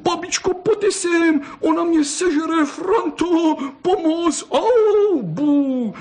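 put-on very high wailing little boy; bawling. A narrator's reading. Bitten boy calls grandparents and friend, bawling. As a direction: howl for help in a very high, whining cry. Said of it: "zakřikl a spustil".

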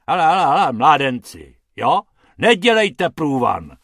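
loud gruff growl at the rabbits; grumpy man barking at two boys. A narrator's reading. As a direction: growl them off. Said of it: "zavrčel pan Hrubec".

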